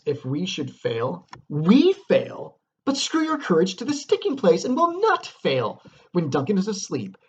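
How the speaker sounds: rally the courage with full force; impassioned stage reading. Acted. Teacher reads the courage speech aloud, impassioned declamation. Reads next lines passionately.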